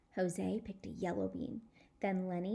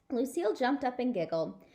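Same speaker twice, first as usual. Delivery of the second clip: ordinary delivery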